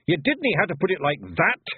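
high, irritated Scottish retort; peeved little Scotsman; snapping. A narrator's reading. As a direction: snap at a tactless mate. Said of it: "he snapped".

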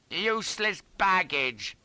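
high, slow, drawn-out shout; shouted reply. A narrator's reading. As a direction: call it back over the noise. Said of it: "shouts I".

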